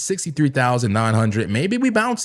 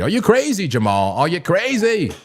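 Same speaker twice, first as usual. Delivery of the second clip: incredulous scolding; 6 semitones higher than usual. Real, not acted. Scolding a chatter.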